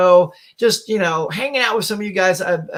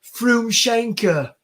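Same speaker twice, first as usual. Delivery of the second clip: slow, drawn-out put-on voice; higher, theatrical character delivery; quoted sitcom character; 5 semitones higher, much slower than usual. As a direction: draw the word out like a comic quote. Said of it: "one of the guys quotes".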